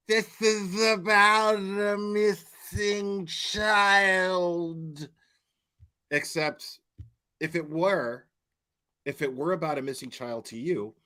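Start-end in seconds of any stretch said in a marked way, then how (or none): 0.1-5.1 dragged-out slow-motion voice. Retold or imitated. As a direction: stretch every word way out. Same line replayed slowed.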